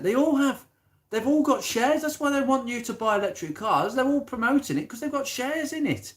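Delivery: high-pitched indignant rant. Real, not acted. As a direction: rant it, voice soaring. Ranting about politicians' shares.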